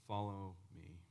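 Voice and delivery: very soft and low; hushed, solemn command. Acted. Jesus sending Peter out, hushed and solemn. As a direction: let the order sink in quietly. Preacher repeats Jesus's order softly.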